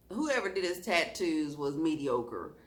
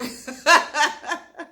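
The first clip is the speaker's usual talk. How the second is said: high loud laugh bursting out; burst of laughter; 9 semitones higher, 9 dB louder than usual. Real, not acted. Telling friends about ex's excuse, high loud laugh. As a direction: laugh hard at the memory. Recalling boyfriend's odd excuse.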